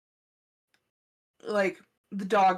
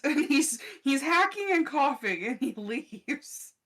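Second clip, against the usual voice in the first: laughing; 5 semitones higher, 4 dB louder, slower than usual. Real, not acted. Laughing while retelling coughing.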